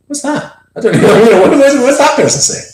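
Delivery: high, loud mock bewilderment with a laugh; baffled listeners.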